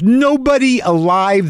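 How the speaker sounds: high, slow, stretched-out insistence; emphatic despair. Acted. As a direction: drive home that nobody can help. Answering the craving for reassurance.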